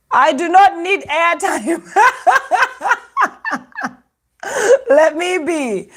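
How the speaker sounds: high, slow laughing plea; laughing exasperation. Real, not acted. Vlogger laughs at her broken Instagram live, pleading. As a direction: laugh through the frustration, begging to be left alone.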